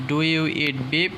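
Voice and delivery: slow, clear question with rising end; teacher modelling a question slowly; slow model question. Shown as a demonstration. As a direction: spell out each word slowly for the learner. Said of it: "তাহলে বলবো এইভাবে".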